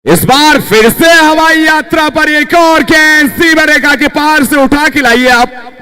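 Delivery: high, slow, loud six call; excited cricket commentary. Real, not acted. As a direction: call the big hit with glee. Another six called.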